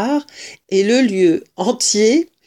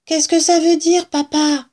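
put-on high child's voice, urgent question; high-pitched, wondering kid; puzzled child; 8 semitones higher, 3 dB louder than usual. A narrator's reading. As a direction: pipe up as a child asking Papa. Fox child questions father.

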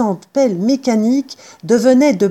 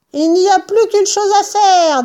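high-pitched decisive character; Bean's farmer voice; 11 semitones higher than usual. A narrator's reading. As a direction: announce the only plan in a high voice. Bean, voix de personnage aiguë. (voix haut perchée, résolue)

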